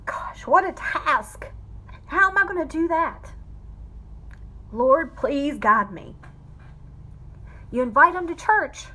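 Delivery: fighting real tears while speaking; holding back tears. Real, not acted. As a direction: voice breaking high and unsteady, close to crying. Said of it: "I'm gonna try not to cry".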